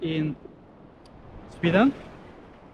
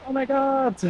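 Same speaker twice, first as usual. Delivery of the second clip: pained yelp; 7 semitones higher than usual. Real, not acted. Burning sand under bare feet.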